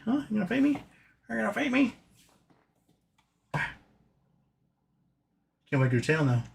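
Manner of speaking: high, soft teasing coo, rising; pet baby talk. Pretend. To the pet wanting a butt scratch, soft high teasing coo. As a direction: tease the pet in a sing-song hush.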